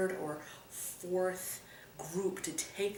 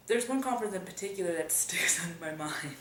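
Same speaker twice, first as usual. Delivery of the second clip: giggles breaking into the words; fighting back laughter through a near-whisper; amused half-whispered telling, laughter slipping out; laughing while telling; faster than usual. Real, not acted. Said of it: "I shouldn't be laughing already".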